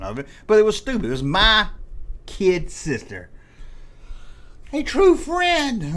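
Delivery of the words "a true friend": high, slow, syrupy ad voice; mock sweet slogan. Sneering at the Kid Sister doll ad, mock sweet slogan. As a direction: sing the slogan with fake sweetness.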